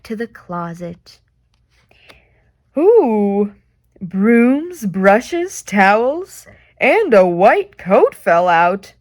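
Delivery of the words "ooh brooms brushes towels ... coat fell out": tumbling excitement. Acted. Things tumble out of closet.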